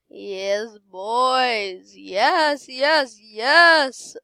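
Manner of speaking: loud, rising repeated cheers; triumphant cheering. Real, not acted. Player celebrating with the boys, loud triumphant cheering.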